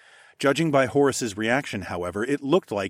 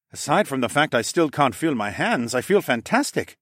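higher, lively character voice; upbeat and delighted, pitched up; Falcon Man, thrilled; 4 semitones higher, 3 dB louder than usual. Acted. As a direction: play the exhilarated alchemist.

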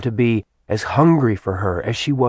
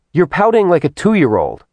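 high-pitched exasperated taunt; acted scolding woman's voice; 8 semitones higher, 4 dB louder than usual. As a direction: tease him with the pitch pushed right up. Woman taunts him for sulking.

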